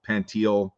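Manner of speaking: [name] said as pan-TEEL; mispronounced surname. Shown as a demonstration. Guest listing versions of his name, [name] said pan-TEEL. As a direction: end [name] on "teel", two syllables. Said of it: "Nobody actually knows".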